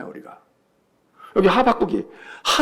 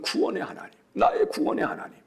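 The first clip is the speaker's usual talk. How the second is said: hushed devotion; 9 dB quieter than usual. Acted. Whispered devotional repetition.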